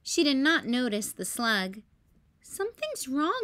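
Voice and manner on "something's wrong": high, puzzled sister voice; worried Ruby. A narrator's reading. Ruby notices the extra guests, puzzled. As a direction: sense something is off. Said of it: "said Ruby".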